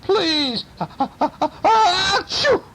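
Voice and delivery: high plea into stuttering sneeze; pleading sneeze. A narrator's reading. Burglar begs aunt, overcome by sneezing, pleading. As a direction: build breathy ahs to a piercing burst.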